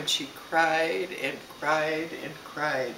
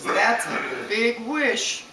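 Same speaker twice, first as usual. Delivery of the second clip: slow, drawn-out, higher godmother line; impressed fairy godmother; 4 semitones higher, much slower than usual. Acted. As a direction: stretch it out, impressed by the wish. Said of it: "the fairy godmother says".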